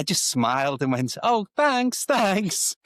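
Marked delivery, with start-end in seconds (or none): 1.2-2.8 polite fake thanks. Retold baffled polite thanks to stranger.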